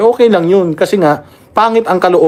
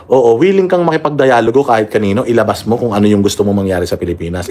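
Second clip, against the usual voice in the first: ordinary delivery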